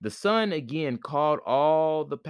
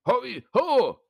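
echoing call; 12 semitones higher, 3 dB louder than usual. Acted. North power answers with echo.

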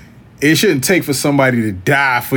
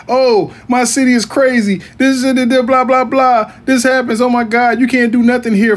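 higher-pitched mimicry of a whiner; mock complaining; 9 semitones higher than usual. People thinking of moving away griping about their city, mock complaining. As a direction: imitate hometown complainers going on and on.

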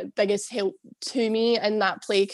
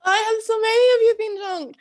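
laughing; 10 semitones higher, 5 dB louder than usual. Real, not acted. Laughing at friend's question.

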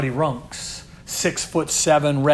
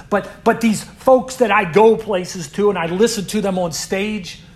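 loud, higher, building to the peak; impassioned pulpit climax; preaching hard; 4 semitones higher, 6 dB louder than usual. Real, not acted. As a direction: preach it out with fire. Preaching at full force.

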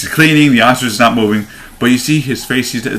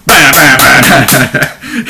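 1 mimicked hits; 3 semitones higher, 5 dB louder, much slower than usual. Imitating gunshots or blows.

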